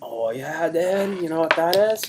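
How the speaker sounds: put-on bro voice, rising at the ends; Chaz. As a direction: brag like an over-excited dude-bro. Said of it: "we're doing characters this week".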